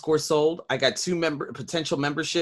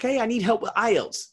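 much higher, lightly voiced request; a hint of a friend asking; 10 semitones higher than usual. Old friend asks for ielts help, pitched higher. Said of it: "he's like".

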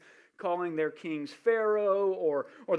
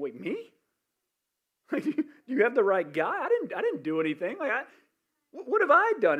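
rising, loud protest of innocence; a king caught out, pleading that he did nothing; panicky, incredulous protest; panicked king; 6 semitones higher, 5 dB louder than usual. Acted.

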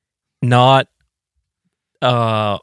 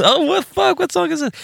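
very high mock-frantic squeak; mock panic. Musician lost at an unknown song, very high squeak. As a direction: squeak it in pretend dread.

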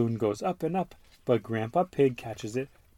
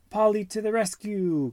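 cheering cry; 11 semitones higher than usual. Acted. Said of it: "cries Grandpa Pig".

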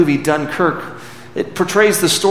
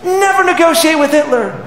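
put-on high, loud child's defiant cry; little girl's cry; 12 semitones higher, 3 dB louder than usual. Five-year-old girl answers Churchill, high and fierce. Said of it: "precious little five-year-old stands up and says".

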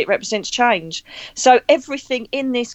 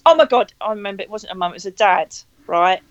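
ordinary talk

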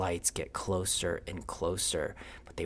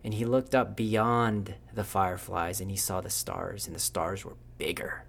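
ordinary delivery

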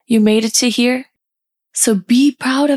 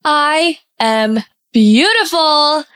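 louder, higher and slow, with pride; loud and proud; 5 semitones higher, much slower than usual. Shown as a demonstration. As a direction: proclaim it like you mean it. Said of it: "Say it loud and proud".

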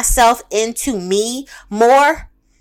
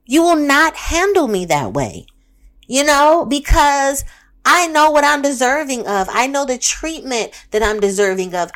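ordinary talk

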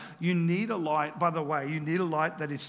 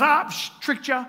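higher, louder gotcha jeer; mocking trickster; 8 semitones higher, 6 dB louder than usual. Preacher acting out someone moving the goalposts, mocking. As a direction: toss it off as a taunting trick. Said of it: "he goes".

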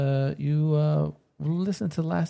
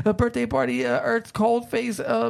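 rattling off a list in a climbing high voice; breathless high-pitched run-on list; running out of breath; 7 semitones higher than usual. Real, not acted. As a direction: race through it on one breath, pitch creeping higher. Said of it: "catch my breath".

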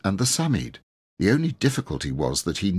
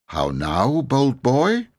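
a knight's lofty challenge, rising louder at the end; haughty; 7 semitones higher, 4 dB louder, much slower than usual. A narrator's reading. Knight rebuking the bold boy, haughty. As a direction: look down on the boy as you ask. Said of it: "asked Sir Wulfric haughtily".

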